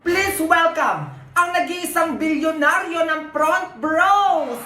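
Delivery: high, slow, drawn-out hype introduction; event announcer. Acted. Host welcoming the billionaire to the event, big announcer delivery. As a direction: announce the star guest to the crowd.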